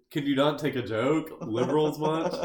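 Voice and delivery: mock right-wing guy. Joker taunting offended liberals in a mock right-wing guy voice. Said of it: "twenty sixteen right-wing guy".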